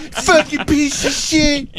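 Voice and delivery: very high, slow hammed-up cursing; mock furious yell. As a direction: stretch out the pretend rage in a high voice. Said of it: "And they'd yell at him".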